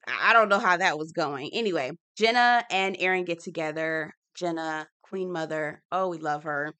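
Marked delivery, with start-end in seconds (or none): none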